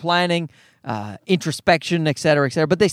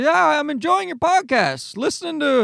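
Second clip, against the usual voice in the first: a light impression of dad, pitched higher; imitating his father; 8 semitones higher than usual. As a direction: do dad's voice, a bit higher. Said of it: "that's how he talks".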